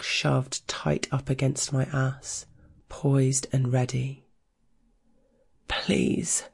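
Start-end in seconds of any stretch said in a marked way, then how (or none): 5.7-6.4 defeated breathy plea; sighing whisper. Acted. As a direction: sigh it out, giving in. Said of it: "with a sigh of both need and defeat".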